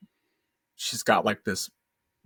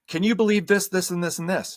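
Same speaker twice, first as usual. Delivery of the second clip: wife voiced in a retold argument; 8 semitones higher, 4 dB louder than usual. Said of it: "And she's like".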